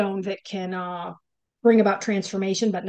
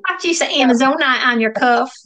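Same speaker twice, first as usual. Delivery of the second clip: bad Southern accent; 4 semitones higher, 8 dB louder than usual. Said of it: "I just sound ridiculous when I try to sound Southern".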